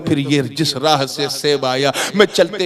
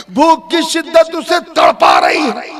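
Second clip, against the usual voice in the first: very high, loud sermon cry; impassioned preaching; 14 semitones higher, 7 dB louder than usual. A narrator's reading. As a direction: preach it at full force. Impassioned preacher telling a story. (पूरे ज़ोर का ऊँचा बयान)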